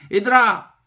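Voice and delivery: put-on high-pitched call to a group of children; lightly acted woodcutter hailing children; passing woodcutter. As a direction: call out to crying kids, then ask. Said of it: "나무꾼이 지나가다가".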